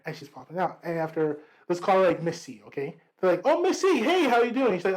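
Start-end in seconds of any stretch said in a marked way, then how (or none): 3.4-4.7 high, chirpy imitation of classmates' hello; cheery greeting. Classmates greet the visiting teacher, mock cheery hello. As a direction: greet the teacher in a raised, eager voice.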